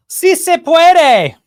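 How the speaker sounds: high, loud, slow mock Spanish chant; mock Jill Biden. Mocking Jill Biden to the audience with a high, loud, slow Spanish chant. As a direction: ham up a badly accented Si se puede. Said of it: "To quote Jill Biden".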